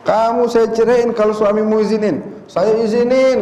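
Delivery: high mock voice for the neighbor's offer; mock woman's voice. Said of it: "kata lakinya". (suara dibuat-buat lebih tinggi)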